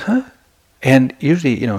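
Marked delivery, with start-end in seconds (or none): 0.0-0.2 high, questioning grunt; puzzled huh. Retold or imitated. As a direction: imitate a baffled teacher, voice jumping up. Repeats teacher's puzzled reaction.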